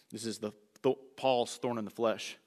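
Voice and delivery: ordinary talk, nothing marked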